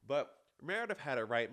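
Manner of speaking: ordinary delivery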